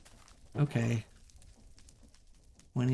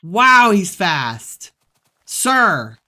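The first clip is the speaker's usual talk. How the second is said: loud, slow alarmed yell; alarmed shout; 5 semitones higher, 14 dB louder, much slower than usual. Real, not acted. Gamer fleeing a fast mob, loud alarm. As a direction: shout in panic at the attacker.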